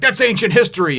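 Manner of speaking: loud, high, angry retort; flying off the handle. Retold or imitated. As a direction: mimic an irate woman snapping back. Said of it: "she's just really flying off the handle".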